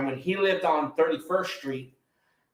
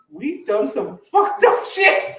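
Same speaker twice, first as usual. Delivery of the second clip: mock hype; 11 semitones higher, 7 dB louder, slower than usual. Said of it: "and then you like".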